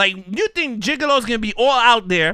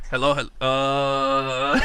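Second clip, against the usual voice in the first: slow, lower, echoing hello; echoey phone voice; 9 semitones lower, 5 dB quieter, much slower than usual. Retold or imitated. Host recreating an echoey caller on the line. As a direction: sound like a far-off phone line. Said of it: "let me get the echo".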